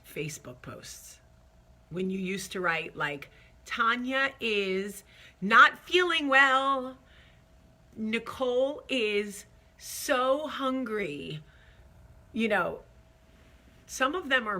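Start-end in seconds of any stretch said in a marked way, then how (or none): 3.6-11.4 slow, exaggerated status-update voice; drawn-out, hammed-up announcement; self-important posting. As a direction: read an old post with mock importance. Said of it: "when we thought we were so sophisticated and cute".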